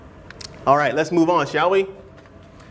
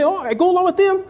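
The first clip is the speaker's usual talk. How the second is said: mock agreeable; 9 semitones higher, faster than usual. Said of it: "You can say".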